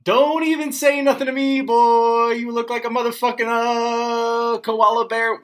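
put-on high, loud yelling kid voice; Vine kid roast. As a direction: shriek the roast like a furious kid. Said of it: "I have to get really loud for these".

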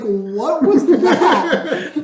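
very slow, high, incredulous question; baffled. Retold or imitated. The crowd reacts to George's outburst with slow high disbelief. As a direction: drag it out in disbelief. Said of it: "everybody's like".

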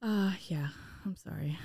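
soft unvoiced whisper. Real, not acted. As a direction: whisper the apology. Whispered apology.

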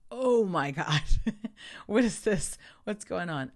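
loud, high alarm with a laugh; hammed-up panicked disbelief; retold panic. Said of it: "And I'm panicking".

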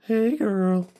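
light sweet princess greeting; a touch of cartoon princess charm; Turtle Princess voice. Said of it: "in Turtle Princess voice".

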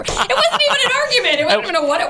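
high, laughing through the words. Real, not acted. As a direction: crack up as you say it. Laughing about their tiny fight.

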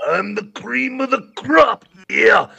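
wrestler voice impression; hammed-up pro wrestler promo; exaggerated cartoon intonation. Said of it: "she's like".

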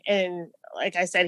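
normal talking voice

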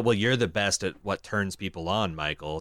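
low, soft, prim robot purr; prissy robot voice. Retold or imitated. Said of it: "he says".

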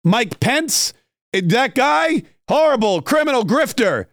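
high-pitched mock tirade; Chris Christie ranting. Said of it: "Chris Christie's not gonna go anywhere and say".

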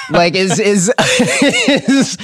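cracking up mid-sentence; words break into high laughter; too tickled to finish the sentence; laughing. Real, not acted. Cracks up after quoting Stewie.

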